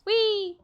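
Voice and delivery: high-pitched drawn-out "whee"; playful whee. Real, not acted. Player amusing themselves mid-game, playful whee. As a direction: let out a high, playful squeal of a word.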